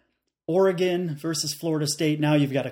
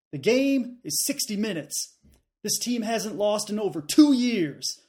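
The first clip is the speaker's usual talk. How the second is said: higher-pitched, put-on Southern coach; mock Southern coach quote, voice raised high; Southern accent; 6 semitones higher than usual. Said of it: "if I can do a good Southern accent".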